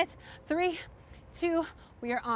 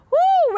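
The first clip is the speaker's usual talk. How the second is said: shouted woo, high and loud; loud high woo cheer; 11 dB louder than usual. Real, not acted. Cheering end of set.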